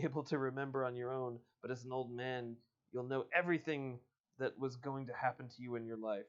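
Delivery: ordinary talk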